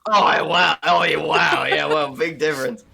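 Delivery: mock-impressed, loud and scoffing; loud sarcasm. Real, not acted. Answering a friend who said moron not idiot, loud sarcasm. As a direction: scoff loudly at the weak excuse.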